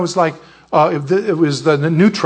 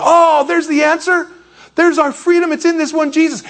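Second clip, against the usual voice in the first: joyful realization; 12 semitones higher, 3 dB louder than usual. Retold or imitated. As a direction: exclaim it high, as if the answer just dawned. Villagers' joyful discovery, acted out.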